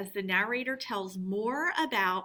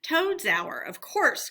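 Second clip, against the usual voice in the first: higher, louder happy exclaim; delighted cry; 6 semitones higher, 4 dB louder, slower than usual. Acted. Mole answering badger, delighted. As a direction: burst out with glee. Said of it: "cried the mole delightedly".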